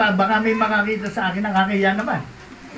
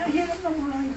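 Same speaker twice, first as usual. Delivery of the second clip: quiet lament; 4 semitones higher, 6 dB quieter than usual. Retold or imitated.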